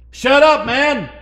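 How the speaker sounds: sharp high shout to hush a beggar; mock shout. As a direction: snap it like an annoyed crowd. Crowd hushing blind beggar.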